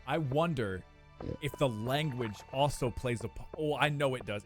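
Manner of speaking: ordinary talk, nothing marked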